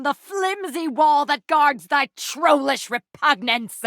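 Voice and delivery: high, slow, furious outburst; outraged shout. Acted. Knight driving off the visitor who insulted Diogenes, furious shout. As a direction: rise to a scandalized roar at the affront.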